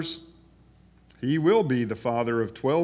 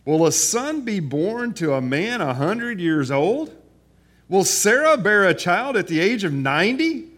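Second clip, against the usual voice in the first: incredulous Abraham; 7 semitones higher, 7 dB louder than usual. Acted. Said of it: "He laughed and said to himself".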